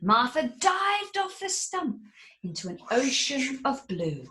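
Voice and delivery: plain reading voice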